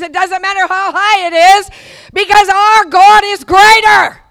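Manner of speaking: high, loud, slowing shout; preaching at full force. Acted. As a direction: climax the sermon with a roar.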